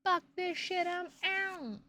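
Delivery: high, squeaky rage-quit voice; mock rage quit. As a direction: quit in a huff like a cartoon. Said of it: "Fuck it, I'm outta here".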